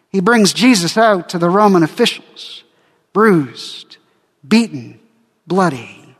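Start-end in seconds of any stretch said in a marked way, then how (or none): none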